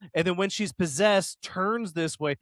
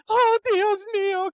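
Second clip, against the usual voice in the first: high, slow, shocked Oh, Dios mío; shocked Mexican grandma; 5 dB louder than usual. Retold or imitated. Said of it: "Grandma's all like".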